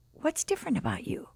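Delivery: quiet, breathy wondering question; soft curious question. Retold or imitated. Roommate sensing a change in her, hushed. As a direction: ask it gently, almost whispered.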